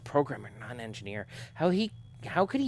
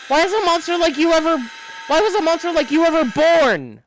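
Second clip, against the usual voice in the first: much higher, much louder shriek; shrieking why; anguished scream; 14 semitones higher, 9 dB louder than usual. Acted. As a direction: scream it at the monster. Screaming accusation at the android.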